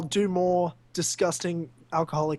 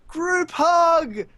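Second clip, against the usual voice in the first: much higher and louder, a chirpy shout; cheery group hug call; 11 semitones higher, 5 dB louder than usual. Retold or imitated. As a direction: sing it out like a clueless happy drunk. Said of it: "comes up and goes".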